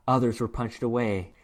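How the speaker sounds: ordinary delivery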